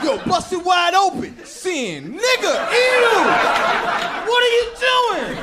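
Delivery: high, shouted revulsion; disgusted outrage. Acted. Comic reacting to the nasty emails he read, loud high disgust. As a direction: holler in appalled disgust.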